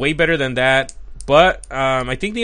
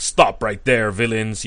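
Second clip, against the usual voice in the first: lower, commanding superhero voice; Batman; 5 semitones lower, slower than usual. Retold or imitated. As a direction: drop the voice to order villains to stop. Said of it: "his voice gets a little lower".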